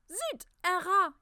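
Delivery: high, loud shriek in French; startled scream. A narrator's reading. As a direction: shriek at the sudden sight of a rat. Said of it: "Yasmina screamed".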